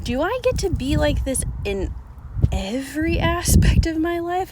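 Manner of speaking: excited high question, imitating herself; thrilled disbelief. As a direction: ask it like you just found freedom. Thrilled at new freedom.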